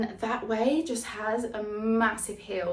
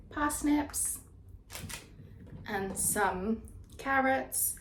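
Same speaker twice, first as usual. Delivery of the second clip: drawn-out list; 5 semitones higher, much slower than usual. Real, not acted. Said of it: "why am I doing it like this?".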